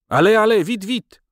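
high, loud urging; loud burst, pitched very high; urgent hurrying shout. Acted. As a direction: hurry them along with a shout. Hurrying others at the door.

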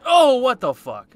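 a high, loud, furious "Oh, what the fuck?"; real angry shout. Real, not acted. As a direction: blurt it out, genuinely fed up. Another sudden hit in game.

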